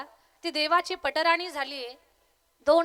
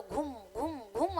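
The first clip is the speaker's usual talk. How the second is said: low droning boom, rising and falling in waves; resonant, swooping imitation of churning; imitated churning hum; 3 semitones lower, 6 dB quieter than usual. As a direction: hum the full pot's churning sound. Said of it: "आवाज येईल".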